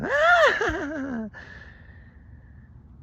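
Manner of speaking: loud, shrieking high cackle; witch's cackle. A narrator's reading. Witch laughs as he gets punched, high screeching cackle. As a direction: let a wicked laugh rip and trail away. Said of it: "she starts laughing".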